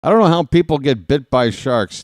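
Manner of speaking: ordinary delivery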